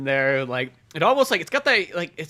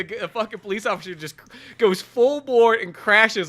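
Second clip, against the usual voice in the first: ordinary talk, nothing marked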